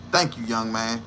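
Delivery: a hint of a relieved lawyer thanking him; grateful old lawyer. Voicing grateful public defender.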